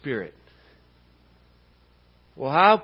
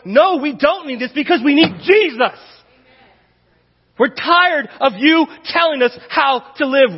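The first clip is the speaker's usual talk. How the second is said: higher and louder, driving each warning home; fiery preaching; 8 semitones higher, 6 dB louder than usual. Acted. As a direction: preach it hard to the church. Preaching at full force.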